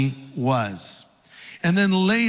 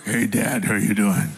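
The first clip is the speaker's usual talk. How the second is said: lower, fake deep voice of his brother; imitating deeper Esau; 7 semitones lower than usual. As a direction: Jacob pretending to be Esau with a put-on low voice. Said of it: "tries to imitate the voice of his brother, who I imagine was deeper".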